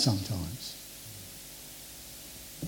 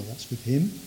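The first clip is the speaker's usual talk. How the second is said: normal talking voice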